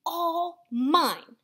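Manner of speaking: acted grouchy yell; storybook scream. A narrator's reading. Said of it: "screamed the ladybug".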